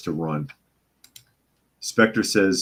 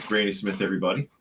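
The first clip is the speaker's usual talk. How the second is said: ordinary talk, nothing marked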